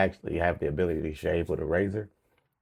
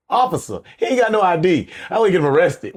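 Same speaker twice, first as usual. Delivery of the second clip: loud, high mock call for an officer; mock calling police; 8 semitones higher, 7 dB louder than usual. Joking about getting the carded man arrested, loud mock call. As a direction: pretend to holler for the cops.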